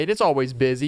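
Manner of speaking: normal talking voice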